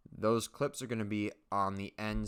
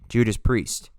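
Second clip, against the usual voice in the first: mock exclamation; 3 semitones higher, 13 dB louder, slower than usual. Shown as a demonstration. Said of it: "Sorry, not Jesus Christ".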